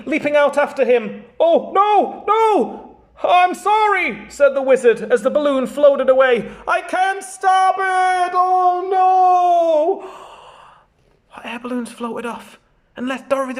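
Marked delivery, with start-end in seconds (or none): 1.4-10.1 1 dismayed wizard. Acted. Wizard's balloon floats away.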